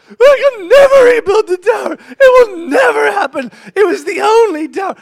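put-on high, loud sobbing girl wailing about her tower; wailing child. Father imitating his daughter wailing over the tower. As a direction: wail it high and loud like a crushed little girl.

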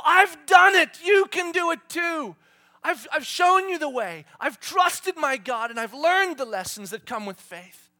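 loud, high triumphant call; shouting heroes of faith. Acted. Heroes of faith cheering the listeners on, triumphant shout. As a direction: shout it to the crowd like a victor. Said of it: "and they go".

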